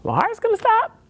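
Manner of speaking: very high, incredulous squeak; mock disbelief. Told by EMTs he's having a heart attack, very high disbelief. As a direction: overplay the shock in a high squeak.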